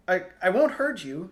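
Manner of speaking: stammered, then called out loud; loud reassurance. A narrator's reading. Tristan to hidden crying girl, loud and reassuring. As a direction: call out loudly that you mean no harm. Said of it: "he said loudly".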